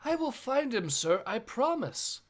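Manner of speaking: earnest young recruit promising, lightly voiced; voiced kid. Reading a comic panel, an eager kid promising the boss. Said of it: "reading the kid volunteering".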